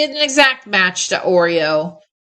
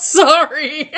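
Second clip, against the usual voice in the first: giggling apology, pitched up; laughing sorry; 6 semitones higher than usual. Real, not acted. Apologizing after sneezing, laughing.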